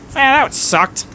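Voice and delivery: high put-on grumble from onlookers; crass heckler. Merchant crew remarking after the fight, crassly. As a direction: toss it off in a high crowd voice. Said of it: "crass, uh, sort of hard to hear remarks".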